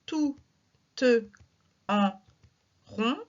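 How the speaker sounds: each syllable set apart by long pauses; slow scansion with long gaps. Shown as a demonstration. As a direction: beat out "Tout te en rond" one syllable at a time. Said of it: "Un, deux".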